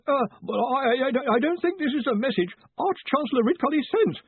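very high, stammering squeak; strained, high-pitched nervous voice; squeaky with nervousness. A narrator's reading. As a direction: squeak it out nervously, tripping over the start. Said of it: "his voice gone squeaky with nervousness".

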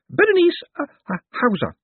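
very high, stammering over the surname; flustered lady. A narrator's reading. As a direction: start high and loud, then falter. Flustered lady gives her name.

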